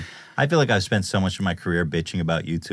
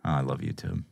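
defeated sigh; 5 semitones lower, 8 dB quieter than usual. Pretend. Mock gimp surrender.

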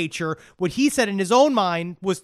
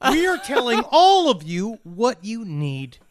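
high, grandiose proclamation slowing at the end; hammed-up courtroom ranter, pitched up; self-appointed leader; 5 semitones higher, 3 dB louder than usual. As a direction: announce your crew like a pompous crank on the stand. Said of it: "Bill O'Reilly".

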